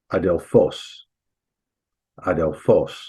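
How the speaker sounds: "adelphos" begun on a plain vowel, no h; smooth-breathing pronunciation. Shown as a demonstration. Teacher showing Greek students a smooth breathing word, demonstrated pronunciation. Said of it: "you just, you just pronounce it".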